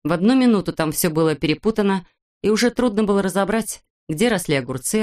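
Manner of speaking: usual speaking voice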